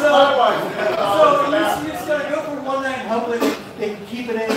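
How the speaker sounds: loud laughter through the words; cracking up mid-joke; laughing. Real, not acted. Laughing at own bathroom joke.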